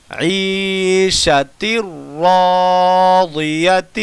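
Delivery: drawn-out chanted recitation; slow Quran recitation. Shown as a demonstration. Teacher reciting the lesson's words for students to repeat, slow and chanted. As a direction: recite each phrase slowly, letting vowels ring.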